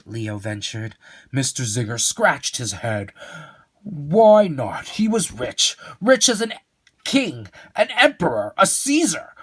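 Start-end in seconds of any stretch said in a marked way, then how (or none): 3.8-9.3 excited build. Acted. Growing excited storytelling.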